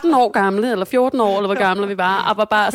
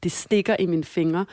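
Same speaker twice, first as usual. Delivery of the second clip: ordinary delivery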